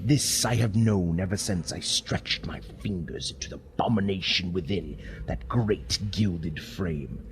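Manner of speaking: plain reading voice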